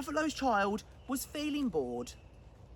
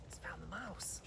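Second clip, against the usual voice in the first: suspenseful hushed whisper; tense storyteller whisper; 6 semitones lower, 15 dB quieter than usual. Acted. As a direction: breathe it out like a secret. Narrator whispers suspense to listeners.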